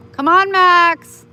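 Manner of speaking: high, loud, slow call; calling the dog. Real, not acted. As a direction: coax the dog along loudly.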